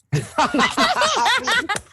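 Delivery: very high, loud real laugh; high burst of laughter. Real, not acted. Player seeing a ninety-eight roll, high laughter.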